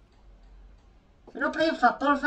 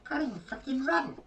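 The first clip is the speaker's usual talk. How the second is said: normal talking voice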